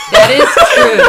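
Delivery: high, loud words through laughter; laughing hard. Real, not acted. Cohost agreeing with a joke, laughing hard. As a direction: crack up while conceding the point. Amused reply amid shared laughter.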